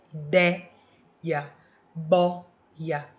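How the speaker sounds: Ideia and boia with wide-open é and ó, stressed and clear; open vowels. Shown as a demonstration. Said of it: "tem som de é e essa daqui tem som de ó". (pronúncia aberta: idÉia, bÓia)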